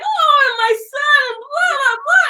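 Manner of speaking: high, loud mock lament; hammed-up high, upset moaning; upset complainer. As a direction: imitate her wailing complaint. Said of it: "like, she's upset".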